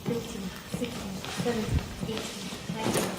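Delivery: counting cpr compressions. Real, not acted.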